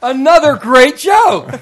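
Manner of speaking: high, drawn-out laughing shout; exclaiming shout. Real, not acted. Host hailing a guest's bridge joke, exclaiming shout. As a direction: belt it out slowly through laughter.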